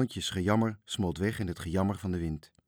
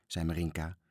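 plain reading voice

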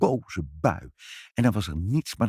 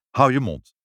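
stern fatherly order, a little raised; 4 semitones higher, 4 dB louder than usual. A narrator's reading. Father silencing his child, stern. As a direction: cut the child off with firm authority. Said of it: "zei vader streng".

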